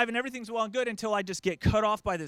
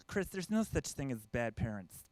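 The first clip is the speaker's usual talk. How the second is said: hippie friends; 7 semitones lower, 6 dB quieter than usual. Retold or imitated. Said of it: "they all go".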